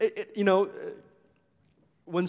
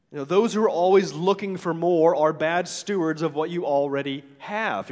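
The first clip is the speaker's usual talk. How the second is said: usual speaking voice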